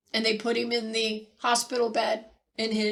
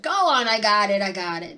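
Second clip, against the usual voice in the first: gruff; 3 semitones lower, 4 dB louder than usual. Retold or imitated. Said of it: "he gruffly said".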